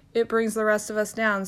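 ordinary talk, nothing marked

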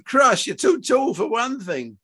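high, drawn-out exclamation; incredulous father, slow and high; exasperated objection. Acted. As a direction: scoff at the very idea.

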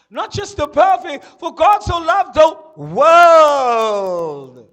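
loud and slow, building to a full shout; preacher's climax, loud and drawn out; rising to a shout. Real, not acted. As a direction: build it up and let it ring out.

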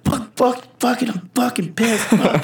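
high-pitched mock fit of swearing, not shouted; retold angry cursing. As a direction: replay a swearing tantrum at no extra volume. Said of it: "I was so mad".

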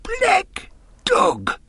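high, strangled cry of recognition; shocked gasp. Acted. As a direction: gasp the name as if seeing a ghost. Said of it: "The captain made a sort of gasp".

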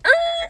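high-pitched tire-screech "er"; screech imitation. Friend mimicking his brother's take-off at the green light, screech imitation. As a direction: imitate a car screeching off the line.

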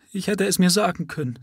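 high, half-sobbed lament; sobbing. A narrator's reading. Mother sobbing over her son's refusal. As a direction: break into a high sob. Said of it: "schluchzte sie".

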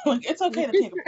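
laughing. Real, not acted. Laughing while joking about naps.

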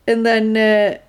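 ordinary delivery

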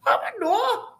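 high, giggly I don't know; helpless giggling reply; laughing. Retold or imitated. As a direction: squeak it through laughter. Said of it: "And I says".

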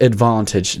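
"advantage" with a broad British "ah"; British pronunciation. Shown as a demonstration. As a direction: say "advantage" with a long ah in the middle. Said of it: "like advantage instead of advantage".

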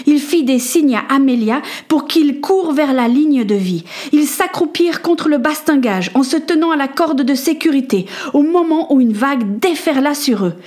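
plain reading voice

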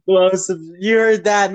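usual speaking voice

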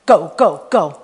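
low, loud, punchy order; urgent retold command. The Lord sending the preacher after a woman, imitated urgent command. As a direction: push each word out low and hard. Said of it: "like that".

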